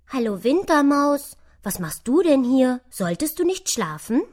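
lautes, erstauntes Eichhörnchen, höhere Stimme; erschrocken. A narrator's reading. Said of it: "Erschrocken sah das Eichhörnchen". (erschrocken und verwundert)

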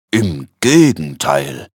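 solemn, drawn-out low interjection; feierliche Betonung. A narrator's reading. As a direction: stress it solemnly, slow and low. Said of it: "mit feierlicher Betonung". (feierlich und gedehnt)

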